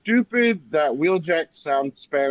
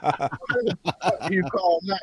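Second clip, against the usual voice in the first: incredulous self; 7 semitones higher, slower than usual. Retold or imitated. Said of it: "I'm like".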